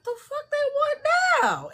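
shrill high exasperation; high-pitched irritated whine; annoyed. Retold or imitated. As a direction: squeal at the ping. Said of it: "I'm like".

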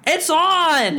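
high, eager shout of news; excited call. Pretend. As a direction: burst in with the big news. Calling friend when episode drops.

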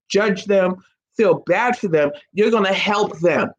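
usual speaking voice

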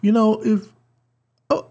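N=Taika Waititi/public; attempted Taika accent. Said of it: "I was gonna try to do the accent".

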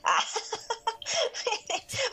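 high giggling laugh; laughter. Real, not acted. Woman amused at her made-up word for surviving, giggling. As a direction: giggle at your own words.

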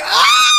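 very high, loud imitated shriek; mother's shocked shriek. Imitating her mother's gasp at the reply, a high shriek. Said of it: "my mother was just like".

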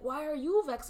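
high, loud mock challenge; imitated irritation. Wife imitates husband asking why she is vexed, mock irritated. Acts out husband's side of quarrel.

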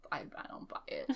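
low, breathy whisper. Real, not acted. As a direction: whisper it under your breath. Whispered aside.

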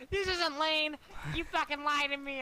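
high-pitched mock outrage; indignant accusation. Duped buyer confronting the seller a month later, high mock indignation. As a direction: imitate a cheated customer whining in protest.

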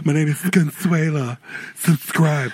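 lightly voiced sultry woman; guy playing Consuela.

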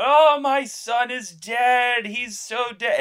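very high, loud, slow wail; hammed-up bereaved shouting; mock grieving father. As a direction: wail over a son in comic despair. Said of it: "but this guy is going".

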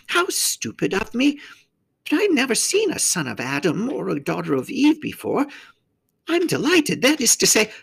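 high-pitched, excitable faun voice; flustered faun. A narrator's reading. Faun meeting his first human, high and flustered. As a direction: gush in a high faun voice, then catch yourself.

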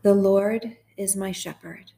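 slow, measured psalm reading with long pauses; guided meditation. Acted. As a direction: read it line by line, unhurried, leaving room to repeat.

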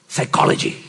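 whispered psychology; scornful whisper. Real, not acted. Pastor whispering psychology with scorn. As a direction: breathe the word with scorn.

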